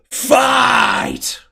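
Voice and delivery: huge, high shout of fight; yelled command. Acted. Grand mage signals the fight to the crowd, full shout. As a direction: bellow it to the whole arena. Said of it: "He raises his hands once more and yells".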